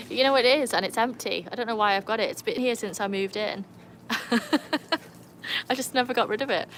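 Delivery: normal talking voice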